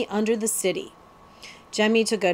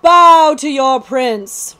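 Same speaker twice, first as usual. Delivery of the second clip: loud, slow royal proclamation, pitched up; imperious command; 5 semitones higher, 10 dB louder, much slower than usual. A narrator's reading. Heir to the throne demands a bow, imperious command. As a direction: ring it out grandly, word by word. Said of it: "raised himself to his full height".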